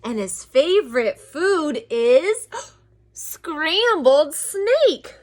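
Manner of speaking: slow, rising build to a high, loud last word; drawn-out threat. Acted. Mouse warning snake about the Gruffalo, drawn-out playful threat. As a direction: stretch it out for suspense.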